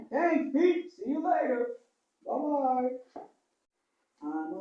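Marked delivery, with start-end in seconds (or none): none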